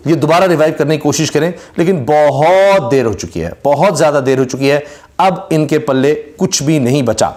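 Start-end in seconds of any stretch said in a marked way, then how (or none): 2.1-3.5 بہت drawn out long and slow; stress on بہت. Real, not acted. Commentator tells viewers a revival comes too late, بہت heavily drawn out. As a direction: stretch the vowel of بہت for emphasis. Emphatic verdict, word drawn out.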